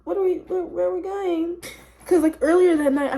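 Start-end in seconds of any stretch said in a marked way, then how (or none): 0.0-1.6 high-pitched mock bewilderment; confused panic. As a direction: ask it high and lost, as if stoned. Retold confused questioning.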